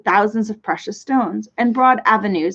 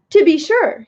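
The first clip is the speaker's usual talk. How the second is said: proud; 7 semitones higher, 4 dB louder than usual. A narrator's reading. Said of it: "he replied proudly".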